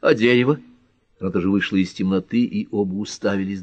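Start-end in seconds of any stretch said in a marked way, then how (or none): 0.0-0.6 high, loud indignant correction; cross, emphatic comeback; angry retort. A narrator's reading. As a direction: correct him crossly, voice raised. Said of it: "сердито откликнулась Пэт".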